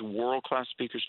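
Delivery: normal talking voice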